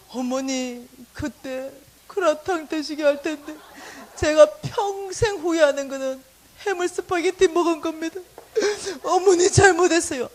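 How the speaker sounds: high, slow, tearful lament; mock graveside weeping. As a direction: overdo a sobbing confession. Mother mimics son's future graveside regret.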